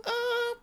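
high shrieking yell; a sudden high scream, hammed up. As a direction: shriek as the ball flies. Another swing scream.

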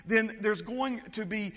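fired-up sermon delivery; preaching. Acted. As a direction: preach it with rising force. Preaching at full force.

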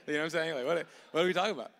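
mock-baffled, rushing and loud; loud disbelief. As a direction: throw it out like you can't believe the name. Hammed-up disbelief at the name.

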